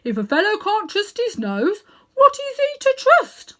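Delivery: very high, loud outraged bark; indignant bulldog. Acted. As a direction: demand an answer, scandalised. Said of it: "said the bulldog".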